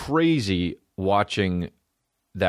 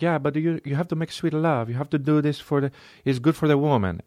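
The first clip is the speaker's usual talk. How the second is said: higher, lightly hammed Italian accent; 6 semitones higher than usual. An Italian guy lectures a Russian on love, Italian accent. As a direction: play the eager Italian lover, pitched up. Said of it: "an Italian guy's like".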